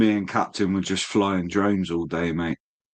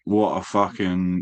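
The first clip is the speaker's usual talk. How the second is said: ordinary talk, nothing marked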